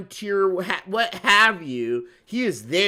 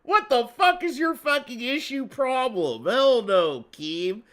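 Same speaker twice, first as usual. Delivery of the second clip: loud, high crowd backlash; acted outraged refusal; 6 semitones higher, 3 dB louder than usual. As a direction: shoot the idea down as an outraged crowd. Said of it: "everybody's like".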